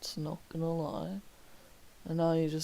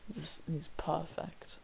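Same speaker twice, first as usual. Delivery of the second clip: breathy hushed whisper aside; 6 dB quieter than usual. Acted. Streamer whispering an aside to his viewers. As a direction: drop to a secret whisper.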